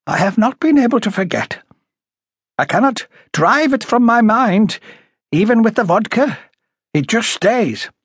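high character voice recounting a horror; troubled high-voiced woman. A narrator's reading. Woman recalling finding the murdered family, high and troubled. Story character, Miss Graham.

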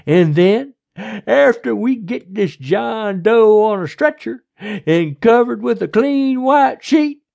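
loud, high, drawling lawman; overbearing sheriff, smug. A narrator's reading. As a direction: lecture her slowly at high volume.

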